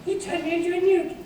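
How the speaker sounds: very high comic falsetto; Monty Python character. Acted. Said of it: "chased the rabbit of Monty Python".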